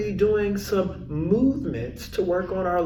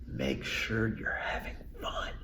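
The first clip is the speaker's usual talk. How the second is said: whispering; 9 semitones lower, 13 dB quieter than usual. Real, not acted. Aside whispered to adults.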